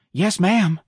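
eager, bright yes; enthusiastic. A narrator's reading. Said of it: "Darlene said enthusiastically".

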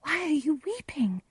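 high, soft questioning voice; Juliet, anxious. Acted. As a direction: ask in a high, hushed, worried tone.

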